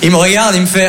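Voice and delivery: usual speaking voice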